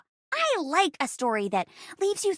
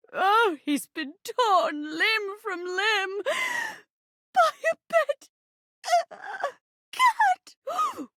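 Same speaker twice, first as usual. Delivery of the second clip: wailed, drawn-out grief rising very high; melodramatic horror; 5 semitones higher than usual. Acted. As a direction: sob out the news in hammed-up horror. Acted horror at husband's death.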